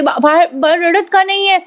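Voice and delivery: stammered start, high anxious pitch; retold distressed question. Mother asks why her baby is silent, acted distress. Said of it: "Kavya आता एकदम उद्विग्न होते".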